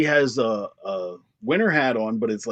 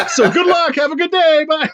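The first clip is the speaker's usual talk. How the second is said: higher, louder, laughing farewell; mock cheery goodbye; 14 semitones higher, 5 dB louder than usual. Clerk mock-waving off a customer, laughing and cheery.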